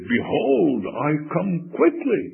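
high, loud imitation of a preacher; repeated preacher's proclamation. Stuck young preacher quoting his text to the crowd, proclaiming. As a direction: proclaim the text, hoping the rest will follow. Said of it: "he leaned over a little further".

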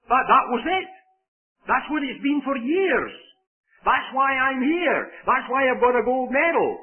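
high, insistent, ringing statements; emphatic athlete's answer. Retold or imitated. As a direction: drive each line home high, like a proud champion retold. Retells champion athlete's emphatic answer.

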